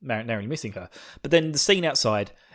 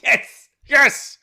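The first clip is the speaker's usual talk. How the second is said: very high, loud squeal of joy; gleeful yes; 13 semitones higher, 9 dB louder than usual. Retold or imitated. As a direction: squeak it out in delight. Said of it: "it's just like".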